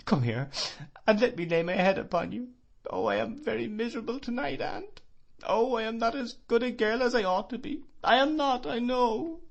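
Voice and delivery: very high, broken sobbing. Acted. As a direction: wail it miserably. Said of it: "sobbed Emily".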